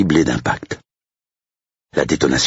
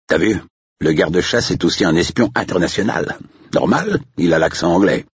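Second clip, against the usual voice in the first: higher-pitched jeer; mocking estate agent; 5 semitones higher than usual. Older agent mocks the gamekeeper to his colleague, jeering. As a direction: poke fun for a colleague's benefit.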